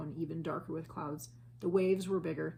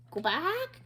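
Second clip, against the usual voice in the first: much higher parrot voice; parrot squawk; 12 semitones higher, 6 dB louder than usual. A narrator's reading. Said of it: "said Polly".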